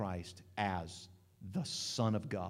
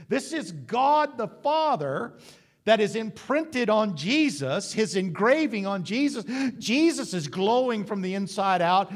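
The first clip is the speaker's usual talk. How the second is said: full-force preaching; 8 semitones higher, 7 dB louder than usual. Acted. Preacher proclaiming the Father's glory on Jesus, full force. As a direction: lift the room, proclaim it.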